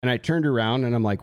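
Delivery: normal talking voice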